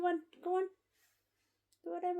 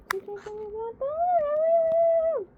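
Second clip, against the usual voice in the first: high, slow put-on pet voice; voicing the bird; 13 semitones higher, 8 dB louder, slower than usual. Owner voicing her bird, high and slow. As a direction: speak for the bird in a drawn-out high voice.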